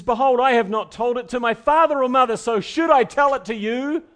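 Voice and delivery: loud preacher voicing a defiant Samson; Samson's indignant retort. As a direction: fling the question back at her.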